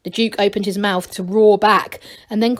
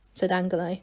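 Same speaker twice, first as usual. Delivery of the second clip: usual speaking voice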